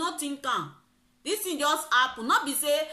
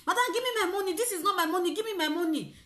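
retold screaming; 8 semitones higher, faster than usual. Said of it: "I started screaming".

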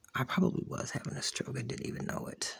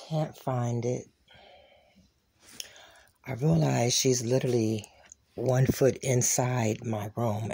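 normal talking voice

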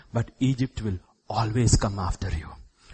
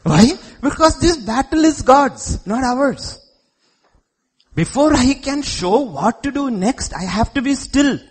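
high, loud, emphatic preacher; preaching at full force; 12 semitones higher, 8 dB louder than usual. Real, not acted. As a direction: drive the point home loudly, high in the voice.

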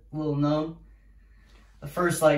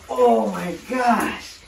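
dreading; 6 semitones higher, 4 dB louder, much slower than usual. Real, not acted. Stepping toward ice.

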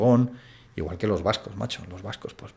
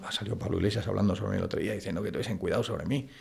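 ordinary delivery

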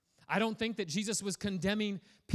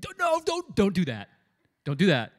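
loud, urgent mock pleading; panicked warning; 4 semitones higher, 11 dB louder than usual. Disciples begging Peter not to leave the boat, loud. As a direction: stop him, loud and urgent.

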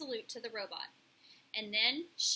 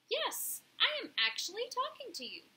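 high-pitched, measured robot voice; polite robot; 10 semitones higher than usual. A narrator's reading. Robot Roz answers the pigeons.

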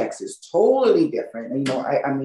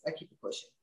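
muttered aside; 2 semitones lower, 19 dB quieter, faster than usual. Real, not acted. Resigned aside.

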